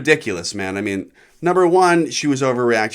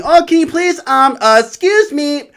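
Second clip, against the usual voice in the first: very high, loud sugary voice; mock polite girl; 13 semitones higher, 7 dB louder than usual. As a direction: squeak the polite request way up high. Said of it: "Not everyone's asking me".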